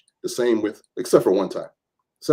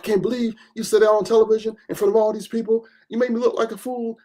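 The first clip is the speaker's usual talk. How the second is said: higher, imitating an upset crying woman; mock her hurt anger in the hallway, higher; upset and mad; 11 semitones higher than usual. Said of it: "she was crying. She was mad".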